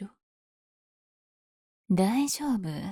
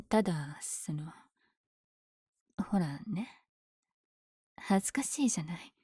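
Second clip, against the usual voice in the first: shy, hesitant whisper; embarrassed whisper; 2 semitones lower, 8 dB quieter than usual. Acted. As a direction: mumble it, flustered. Embarrassed to confess.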